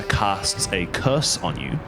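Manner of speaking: usual speaking voice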